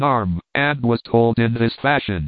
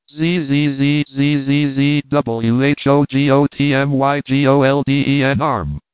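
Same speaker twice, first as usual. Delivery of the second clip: buzzing hollow ghost voice; ghostly buzzing wail. Acted. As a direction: drone it like a ghost in the wind. Ghost voice repeated.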